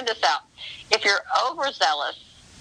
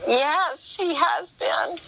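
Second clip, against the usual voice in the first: sheepish agreement; 11 semitones higher, slower than usual. Retold or imitated. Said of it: "And I said".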